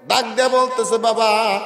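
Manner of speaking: high, loud sung-out narration; preaching at full force. A narrator's reading. Preacher telling of halua fed to the old woman, high loud chant. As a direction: belt out the tale in a sermon chant.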